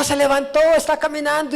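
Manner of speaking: rising excitement at a miracle heard of; excited. Retold or imitated. Excited retelling of news. (emoción creciente, más aguda)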